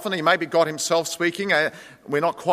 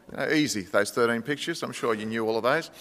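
quiet teasing aside; 8 semitones lower, 7 dB quieter than usual. Real, not acted. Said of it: "teasing aside to congregation".

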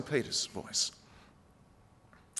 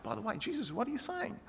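much softer, higher aside; hushed aside; 8 semitones higher, 14 dB quieter than usual. Retold or imitated. Peter quietly pulling Jesus aside, hushed and pointed. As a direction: lean in close so nobody else hears. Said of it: "He said".

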